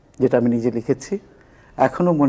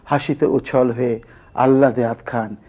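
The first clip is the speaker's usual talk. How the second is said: measured, rhythmic verse recital; comic poem recitation; 2 semitones higher, 4 dB louder than usual. Acted. Former student reciting their teasing poem about a campus girl, comic recitation. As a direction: recite it like comic rhyming verse, leaning on the beats.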